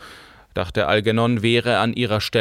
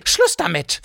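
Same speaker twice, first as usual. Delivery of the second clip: put-on hissing old woman; 8 semitones higher than usual. A narrator's reading. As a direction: cut her off hard. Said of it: "fauchte die Greisin". (fauchende Greisin)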